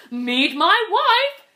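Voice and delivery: loud, slow, proud bird voice; a bird showing off his wife; proud little bird. Acted. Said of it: "said the bird".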